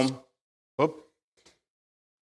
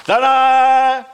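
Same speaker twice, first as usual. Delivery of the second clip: high, loud, bright-eyed fanfare; sudden gleeful burst on a held note; triumphant ta-da; 12 semitones higher, 9 dB louder than usual. Acted. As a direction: belt it out like a big reveal. Acting waking up resilient next morning.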